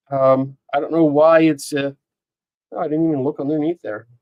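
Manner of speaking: ordinary delivery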